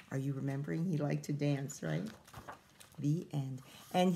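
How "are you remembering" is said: usual speaking voice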